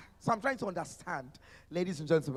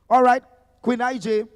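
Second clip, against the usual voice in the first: loud, high handoff; hype call; 5 semitones higher, 11 dB louder than usual. Real, not acted. Emcee loudly passing the mic to the moderator. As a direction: hand over the mic with energy. Host hands over with a shout.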